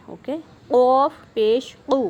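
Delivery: قاف loud and heavy with a bouncing echo; heavy echoing qaaf. Shown as a demonstration. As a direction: make قو thick and bouncing. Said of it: "کلکلا بھی کرنا ہے، اس کو موٹا بھی کرنا ہے". (موٹا کلکلا والا قو)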